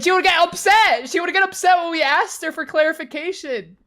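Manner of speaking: high, loud outraged recounting; exasperated and loud; indignant retelling. Retold or imitated. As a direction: raise it to a loud, high-pitched rant. Hammed-up retelling of teacher upset.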